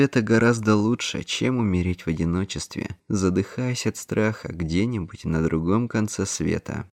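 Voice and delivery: ordinary delivery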